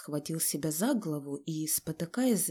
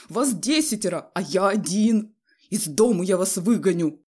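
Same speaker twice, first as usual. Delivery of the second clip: very loud, accusing tirade; tearful rage; 8 dB louder than usual. A narrator's reading. Husband blaming his wife for spoiled children, enraged shouting. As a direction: shout accusations, beside yourself with anger. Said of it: "слёзы выступили у него на глазах от гнева".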